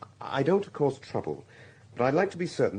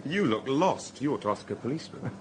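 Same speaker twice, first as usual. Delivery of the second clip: ordinary talk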